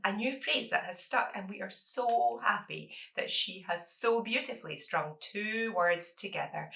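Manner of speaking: ordinary delivery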